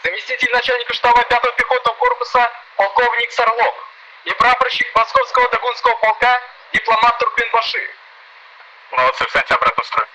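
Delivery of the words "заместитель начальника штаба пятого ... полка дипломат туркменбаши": parade announcer. Acted. Parade announcer reads names.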